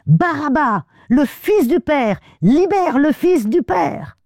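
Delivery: high, slow crowd cry; crowd shouting. Retold or imitated. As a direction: call it out like a mob. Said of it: "la foule de crier".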